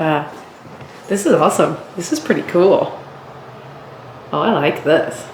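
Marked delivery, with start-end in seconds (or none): none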